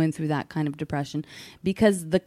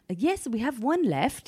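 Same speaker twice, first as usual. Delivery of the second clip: higher, slow put-on British voice; amateur British impression; British accent; 6 semitones higher than usual. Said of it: "He was a British guy".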